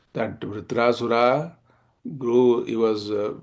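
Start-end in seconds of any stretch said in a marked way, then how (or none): none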